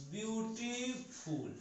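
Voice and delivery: "beautiful" drawn out very slowly and softly while writing; slow dictation. Shown as a demonstration. Teacher to class while writing on board, slow soft dictation. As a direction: spell it out slowly as you write. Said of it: "आप लिख दो".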